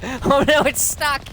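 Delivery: high squeal through laughter. Real, not acted. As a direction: shriek it with glee. Laughing at stuck enemy.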